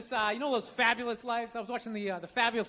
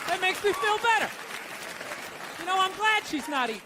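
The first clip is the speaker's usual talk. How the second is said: higher-pitched comic gloating; gleeful spite; 6 semitones higher than usual. Acted. Loser watching TV cheered by twin's illness, gleeful spite. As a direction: gloat with the voice lifted. Gloating over celebrity's misfortune.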